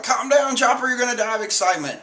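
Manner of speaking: high, quick mock scold; a touch of a cartoon sidekick; Usopp scolding. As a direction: tell the excited friend to settle down. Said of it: "And Usopp's like".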